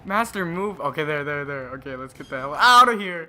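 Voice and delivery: loud, high frantic urging; desperate to escape, yelling; panicking gamer. Real, not acted. Fleeing monster in panic.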